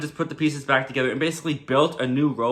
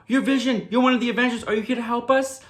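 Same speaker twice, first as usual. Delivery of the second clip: high-pitched, urgent plea; urgent recognition; 8 semitones higher than usual. Retold or imitated. Agnes snaps out of it and sees an Avenger, high and urgent. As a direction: voice jumps up high, acting out sudden recognition. Agnes snapped awake, pleading with Vision.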